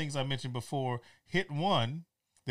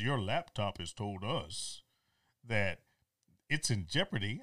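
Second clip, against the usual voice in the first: mock scam caller; 5 semitones lower, 4 dB quieter than usual. Mimicking scam caller's script.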